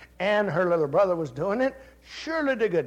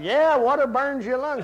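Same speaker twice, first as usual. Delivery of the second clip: insisting loudly; 7 semitones higher, 6 dB louder than usual. Retold or imitated. Insisting to staff member.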